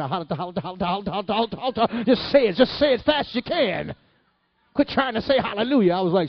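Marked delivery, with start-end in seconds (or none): 0.0-2.0 hallelujah gabbled into a fast stutter; rapid-fire repetition. Retold or imitated. Mother shows child tarrying, fast repeated syllables. 2.0-5.9 high, loud retold urging; urgent coaching. Church mother coaching a tarrying child, urgent and high.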